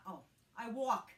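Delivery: usual speaking voice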